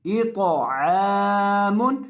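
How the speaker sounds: अश्तातन read very slowly with each syllable held; drawn-out reading. Shown as a demonstration. Teacher showing students the whole word read slowly. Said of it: "teacher reads the whole word".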